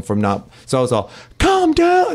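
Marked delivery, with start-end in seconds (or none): 1.4-2.1 very high, squealed mock scream. Shown as a demonstration. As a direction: demonstrate your scream voice, way up high. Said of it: "Like, it's my scream voice".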